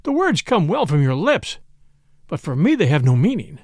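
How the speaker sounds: ordinary delivery, nothing marked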